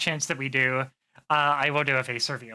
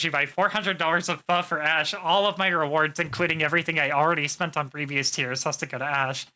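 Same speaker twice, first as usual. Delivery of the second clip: ordinary delivery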